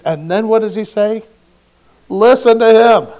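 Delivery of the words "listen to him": louder and higher, a divine command; God ordering the disciples to listen. Pretend. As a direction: boom it down from the cloud.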